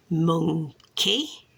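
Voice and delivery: mon, key in two beats, rising at the end; syllable by syllable. Shown as a demonstration. Saying the card for a child, syllable by syllable. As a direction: split monkey in two, lifting on key. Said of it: "say the word for the child".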